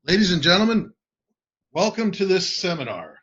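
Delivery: loud, high showman greeting; a booming welcome from the stage; stage host welcome. Shown as a demonstration. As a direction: greet the seminar crowd big and bright. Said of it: "and did this".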